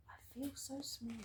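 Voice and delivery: small unvoiced whisper; tiny whisper. Snail whispering to the whale in awe. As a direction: breathe it out, awed and tiny. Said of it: "And she said to the whale".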